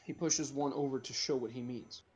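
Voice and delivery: normal talking voice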